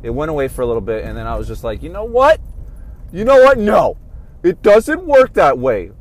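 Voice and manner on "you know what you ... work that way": loud, high, building outburst; reliving a furious comeback, slowing at the end; angry retort. Retold or imitated. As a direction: snap back like you've had enough. Recalling his own angry retort.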